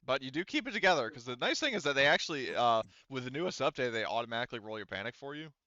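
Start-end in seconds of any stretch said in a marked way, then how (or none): none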